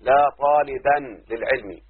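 "لا طالبا للعلم" read slowly, word by word; slow dictation. Shown as a demonstration. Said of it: "مثلًا".

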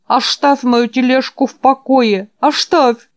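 put-on high, loud old man's cry; toothless old man shouting. A narrator's reading. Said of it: "прошамкал старик".

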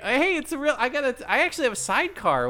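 fast, high excited rambling; eager high voice. Retold or imitated. New guy voiced eagerly.

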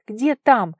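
loud, wary question; alert, wary. A narrator's reading. Vara hears of greater danger, wary. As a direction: ask sharply, suddenly on guard. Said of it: "насторожилась Ваара".

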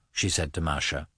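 plain reading voice

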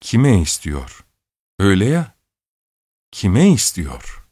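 drawn-out, slow musing repeat; slow, hesitant echo of the question; slow stalling repeat. A narrator's reading. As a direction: stall for time, slowly. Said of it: "diye yutkundu".

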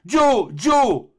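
high, loud calls of horror; terrified cry. Acted. Doctor calling after Joe as he falls, terrified high cry. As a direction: call after him in terror. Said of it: "fit le docteur terrifié".

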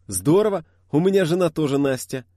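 bright, loud and high; excited exclamation. Acted. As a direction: burst out delighted. Driver exclaims in delight.

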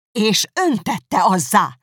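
slow, loud high outburst; vehement cry. Acted. Elizabeth crying out at Darcy, vehement and loud. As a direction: fling it at him. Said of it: "kiáltott fel Elizabeth hevesen".

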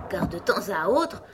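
plain reading voice

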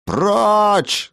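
high, loud, drawn cry of dismissal; enraged yell. A narrator's reading. Craftsman chasing the strangers from his gate, yelling. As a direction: yell it to drive them off. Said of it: "завопил ремесленник".

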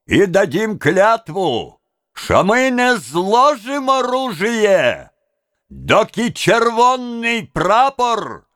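high, drawn-out oath to a crowd; impassioned rally speaker; rousing orator. A narrator's reading. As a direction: proclaim it slowly, pitched high, with rising fervour. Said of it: "Он всё возбуждённее простирал руки".

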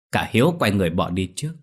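usual speaking voice

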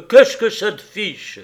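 sharp, loud snap back; loud annoyed husband; irritated retort. Acted. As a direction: throw the question back at her. Husband snaps back at wife.

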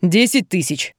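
said through the teeth; name the price reluctantly, with disdain; grudging. A narrator's reading. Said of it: "процедил Раджив". (процедить сквозь зубы)

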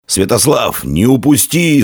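high, urgent narrated call; mild shout in storytelling; shouted urging. A narrator's reading. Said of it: "закричал Всегорд".

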